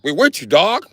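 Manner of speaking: very high loud eager cheer; high excited mock agreement.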